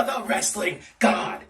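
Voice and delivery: usual speaking voice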